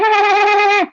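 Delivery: high, loud fake cackle; exaggerated mocking laugh. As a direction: parody their laughing. Mocking laugh after "It's funny.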